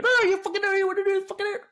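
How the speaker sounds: high mocking screech of a rowdy street woman; rowdy women fighting. Asked for the women fighting impression again, high mock screech. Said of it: "That's exactly how they sound".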